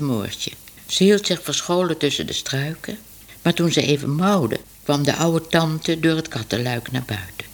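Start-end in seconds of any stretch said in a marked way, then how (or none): none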